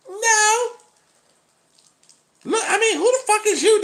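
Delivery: high, loud, defiant woman impression. Woman voiced in dialogue.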